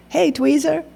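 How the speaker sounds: put-on boy greeting Tweezer, high and loud; boy's greeting. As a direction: call out brightly like a kid. Boy greeting old friend, grinning.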